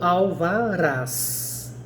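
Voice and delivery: "alvarás" stretched very slowly, syllable by syllable, to show final RÁS; stress on last syllable. Shown as a demonstration. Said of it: "da mesma forma". ("alvarás" bem arrastado, destacando a última sílaba RÁS)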